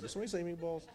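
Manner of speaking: a quick joke voice chiming in about meatballs; goofy voice. Pretend. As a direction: pop in with a silly character voice. Joking aside about Swedish meatballs.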